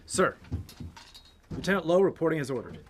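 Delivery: formal military report. Acted. Lieutenant reporting to his general as ordered, formal and loud. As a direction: report in loudly to a superior.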